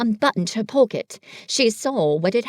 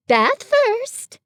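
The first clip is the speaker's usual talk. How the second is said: much higher and bright; cheerful; 13 semitones higher, 4 dB louder than usual. A narrator's reading. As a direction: sing it out happily. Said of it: "in a cheerful voice".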